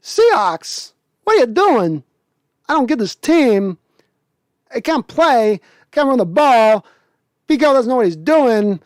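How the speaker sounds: halting Walken rant, pitch climbing at the end; Christopher Walken impression. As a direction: do a self-admittedly rough Walken ranting at the Seahawks. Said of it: "do my Christopher Walken impression".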